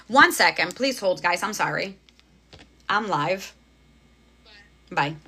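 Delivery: speaking away from the mic; turning away from phone. Real, not acted. Talking aside to daughter off mic.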